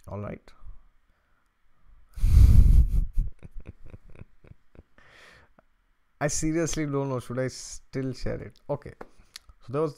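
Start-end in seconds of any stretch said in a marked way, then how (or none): 2.0-4.2 loud, long burst of laughter; real hearty laugh. Real, not acted. Host unsure whether to share the story, laughing hard. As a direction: crack up loudly.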